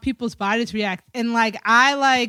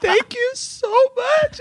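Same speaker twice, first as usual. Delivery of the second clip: high, very slow, drawn-out thanks; hammed-up loopy patient gushing to mommy; overcome with gratitude; 8 semitones higher, slower than usual. Daughter retelling thanking mom after anesthesia, woozily grateful. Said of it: "so grateful".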